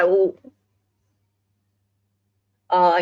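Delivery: ordinary talk, nothing marked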